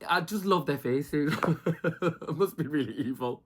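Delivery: amused, breaking into a laugh. Real, not acted. Recapper delighting in the contestants' faces, laughing. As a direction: chuckle through the confession.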